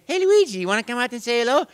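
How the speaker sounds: very high, loud, fast call; Mario. Retold or imitated. Mario coaxes Luigi to say hello, very high and quick. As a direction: coax your brother out. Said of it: "I'd say".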